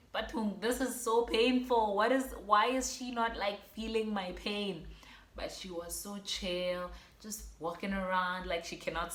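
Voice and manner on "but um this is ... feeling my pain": indignant. Retold or imitated. Outraged at nurse's calm.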